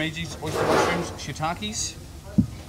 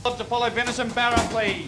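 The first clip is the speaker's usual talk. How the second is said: kitchen order callouts; 9 semitones higher than usual. Real, not acted. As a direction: call the order to the line. Chef calling orders.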